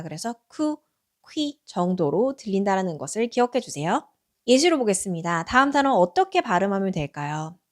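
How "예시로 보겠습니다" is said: ordinary talk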